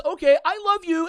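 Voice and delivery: high, sweet voice like talking to a dog; mock pet-talk voice; talking to her dog. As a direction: say it like praising a puppy. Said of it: "as if she's talking to her dog".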